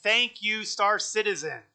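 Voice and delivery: sarcastic thanks. Real, not acted. Sarcastic thanks after crash.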